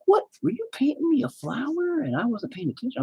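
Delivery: high, mock-innocent wife voice. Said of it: "my wife will be like".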